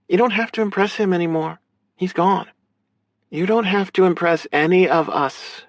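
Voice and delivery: high-pitched sister character voice; earnest, high female voice; caring sister. Acted. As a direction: reassure him as his big sister, high-voiced.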